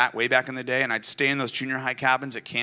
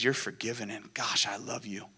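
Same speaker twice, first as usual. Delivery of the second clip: gentle reassurance; 6 dB quieter than usual. Retold or imitated. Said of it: "the answer back is".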